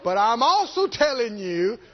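slow, insistent command; slow emphatic warning. Retold or imitated. Holy Ghost insisting to a believer, slow emphatic warning. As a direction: drive the warning home, stretching the words.

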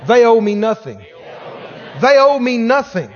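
loud, emphatic congregational prompt. Shown as a demonstration. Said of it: "Everybody said out loud".

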